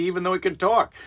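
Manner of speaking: ordinary talk, nothing marked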